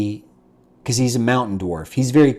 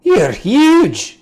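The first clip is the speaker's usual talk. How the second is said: loud, much higher blurt; amazed exclamation; 12 semitones higher, 6 dB louder than usual. Acted. The old dwarf marvels at the tall dwarf, loud and high. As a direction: gasp it out in wonder.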